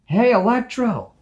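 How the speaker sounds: loud, high shout across a crowd; calling out. Acted. Spider-Man calling to Electro, loud shout. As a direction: yell to get his attention. Someone calls out from afar.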